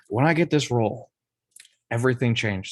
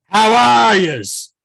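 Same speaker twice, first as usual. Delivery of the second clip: put-on loud, higher thick Boston accent; 3 semitones higher, 5 dB louder than usual. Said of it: "Nick talks in a thick Boston accent".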